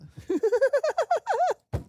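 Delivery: high, loud cackling fit; helpless laughing. Real, not acted. Streamer finds his pasta line hilarious, high helpless laughter. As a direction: lose it completely in giggles.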